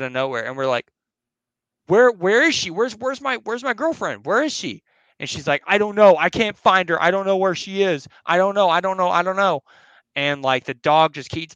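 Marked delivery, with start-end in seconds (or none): 1.9-4.8 high, stammering panic; imitated frantic worry; frantic questioning. Acts out frantic search for girlfriend. 5.7-9.6 higher, repetitive frantic babble; acted-out flustered panic; panicked denial. Acts out panicking cousin.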